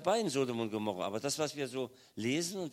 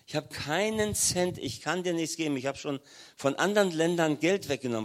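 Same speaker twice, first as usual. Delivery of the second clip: higher, louder insistence on keinen Cent; emphatic refusal; 4 semitones higher, 6 dB louder than usual. Retold or imitated. Pavel refusing Gerhard on the phone, louder and insistent. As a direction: hammer home that there is no money. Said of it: "hat er gesagt".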